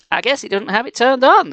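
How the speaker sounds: ordinary delivery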